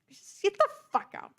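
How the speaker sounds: high-pitched exasperated dismissal; exasperated outburst. Real, not acted. Reacting to terminology they find stupid, exasperated outburst. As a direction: throw it out in high disbelief.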